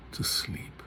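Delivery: hushed, breathy to sleep; whispered to sleep. Shown as a demonstration. Lecturer recites soliloquy.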